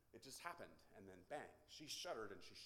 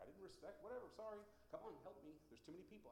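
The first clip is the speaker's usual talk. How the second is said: higher, harried imitation of pleading; exasperated plea; 9 semitones higher than usual. Preacher dragging elders from their chairs, exasperated plea. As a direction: plead in a raised pitch for backup.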